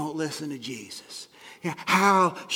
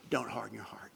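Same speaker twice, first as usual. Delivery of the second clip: whispered, much softer; whispered plea; 7 semitones lower, 9 dB quieter than usual. Real, not acted. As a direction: breathe it close like a plea. The soft take sinks to a whisper.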